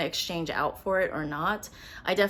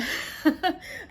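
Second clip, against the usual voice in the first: breathy laugh; 11 semitones higher than usual. Real, not acted. Laughs at her unchanged opinion.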